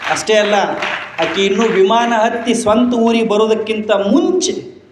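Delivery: rousing sermon climax; triumphant preaching. Acted. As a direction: announce the victory to the crowd.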